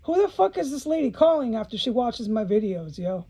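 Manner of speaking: normal talking voice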